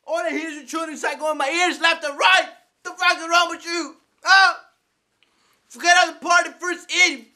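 high-pitched furious yelling; outraged shouting into the mic; angry gamer rant. Acted. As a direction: yell at him to get out, voice way up. Skit, yelling at player in party.

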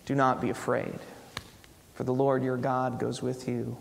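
normal talking voice

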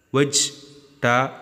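"Vegetable" split as "vej... ta... bul"; syllable by syllable. Shown as a demonstration. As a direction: pause between the three syllables of "Vegetable". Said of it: "Three syllables will act". ("Vegetable" broken into three separate beats)

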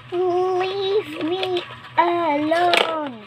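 very slow, high wail; long, high, drawn-out acted shout; fearful shout. A narrator's reading. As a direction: stretch every word into a scared cry. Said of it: "shouted out of fear".

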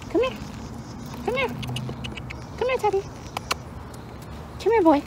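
very high sing-song dog call; high coaxing call. Real, not acted. Owner coaxing Teddy over in a high sing-song call.

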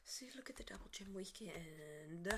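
excited secret whisper. Real, not acted. Gamer whispering to viewers about the game event. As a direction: hush it like sharing a secret.

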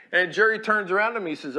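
normal talking voice

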